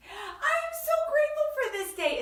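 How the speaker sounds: very high, gushing delivery; high-pitched delight; excited gratitude. Real, not acted. Host exclaims thanks before singing it.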